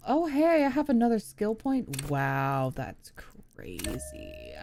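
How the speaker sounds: ordinary talk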